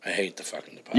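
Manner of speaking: whispered flat contempt; whispered grudge; hushed disgust. Real, not acted. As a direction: confess it under your breath. Blunt confession of dislike.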